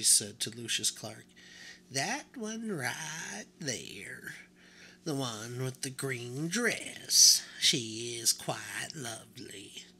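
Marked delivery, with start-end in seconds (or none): 1.9-9.9 put-on slow, breathy old woman; old grandmother. A narrator's reading. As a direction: point her out slowly, fondly. Grandmother choosing a doll.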